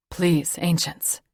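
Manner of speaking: slow, pleading cry to the ancients; desperate plea. Acted. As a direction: beg the gods for a life. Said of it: "he cried".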